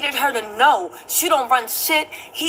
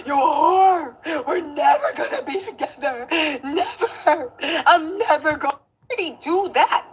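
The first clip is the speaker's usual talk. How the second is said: high whiny fake crying; hammed-up crying accusations; mock sobbing; 3 semitones higher than usual. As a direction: whimper the insults. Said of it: "He was like".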